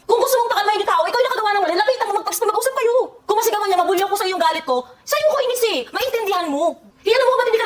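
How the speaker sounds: high, pressing lecture; driving the point home angrily; heated scolding. Real, not acted.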